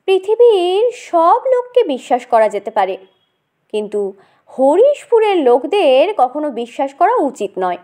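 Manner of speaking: ordinary talk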